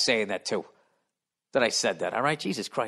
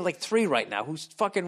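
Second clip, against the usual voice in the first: ordinary talk, nothing marked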